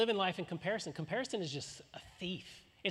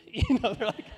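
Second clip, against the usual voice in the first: loud laugh; 5 semitones higher, 10 dB louder than usual. Real, not acted. Preacher laughing loudly at his own grocery store awkwardness.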